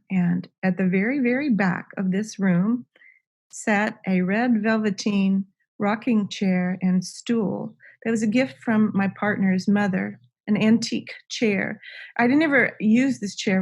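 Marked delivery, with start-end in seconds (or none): none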